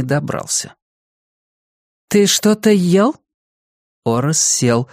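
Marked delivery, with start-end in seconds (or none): 2.1-3.1 suspicious question. Acted. Julia questions dog.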